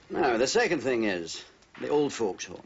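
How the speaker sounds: ordinary talk, nothing marked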